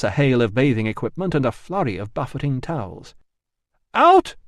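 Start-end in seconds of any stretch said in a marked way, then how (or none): 3.9-4.3 very high, loud scream; furious bathers screaming. Acted. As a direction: scream him out. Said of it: "screamed his tormentors".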